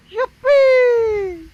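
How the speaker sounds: high gleeful yippee; excited squeal. Pretend. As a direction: squeal with joy.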